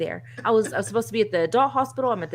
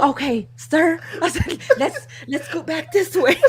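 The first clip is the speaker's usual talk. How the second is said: high-pitched, laughing through the words; amused, high mock politeness; laughing retelling; 8 semitones higher, 4 dB louder than usual. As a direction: coax him along while trying not to crack up. Retold suggestion while laughing.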